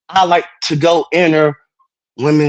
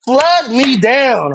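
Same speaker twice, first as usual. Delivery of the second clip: slower still, a touch higher; slower hammed-up plea; 8 semitones higher, much slower than usual. Teasing Darlene on stream, slower higher mock plea. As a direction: drag it out even more, hammed-up.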